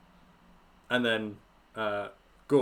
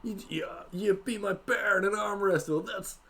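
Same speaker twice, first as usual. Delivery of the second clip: high, stunned bro voice; amazed bro; 8 semitones higher, slower than usual. Acted. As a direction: wow like a hyped bro. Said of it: "Gork's like".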